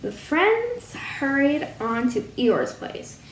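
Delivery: plain reading voice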